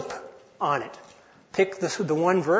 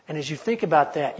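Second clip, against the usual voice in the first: ordinary delivery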